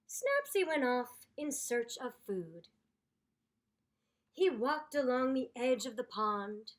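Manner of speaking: storybook read-aloud voice; story narration. A narrator's reading. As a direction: read it like a picture book for kids.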